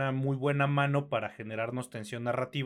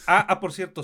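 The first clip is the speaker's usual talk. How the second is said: sudden recall; 6 semitones higher, 10 dB louder than usual. Real, not acted. Sudden remembered aside.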